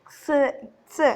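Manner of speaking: "ക്സ്" as k and s run together; letter sound for X. Shown as a demonstration. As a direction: sound out "ക്സ്" twice, each isolated and short. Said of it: "ഇങ്ങനെ കായും സയും".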